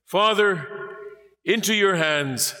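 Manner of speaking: high call to the Father; loud call. A narrator's reading. Dying Jesus calling out to his Father, high loud call. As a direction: lift the words out high and strong. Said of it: "calling out with a loud voice".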